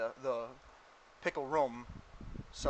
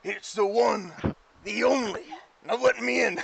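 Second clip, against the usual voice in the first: loud, high, punchy announcer-like holler; showboating visitor; 7 semitones higher, 8 dB louder than usual. Acted. Game master as visitor demanding to be let in, showboating holler. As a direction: announce yourself grandly through the door.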